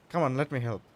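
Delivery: ordinary talk, nothing marked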